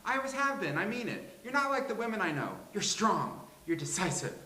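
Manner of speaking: high, quick character voice; brassy comic character. Acted. Complimenting Grace as strong and decisive, high character voice. Acts a figure in script reading.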